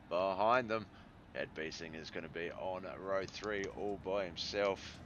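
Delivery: lively play-by-play lineup call; race announcer. Acted. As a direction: announce the grid like a race caller. Calls the heat lineup.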